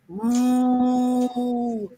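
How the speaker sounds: a hint of a spooky ghost moaning woo.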